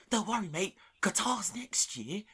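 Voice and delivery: high-pitched, drawn-out fake cheer; imitating a chirpy consoler; mock consolation. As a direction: console him in a loud, high mocking voice. Retelling a man's mock consolation.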